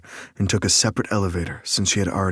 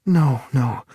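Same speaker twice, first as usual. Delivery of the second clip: higher, louder female voice, protesting; Lake's voice; 7 semitones higher, 5 dB louder than usual. A narrator's reading. Lake stopping Nick's apology, higher and louder. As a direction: Lake cutting him off, high. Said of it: "she said".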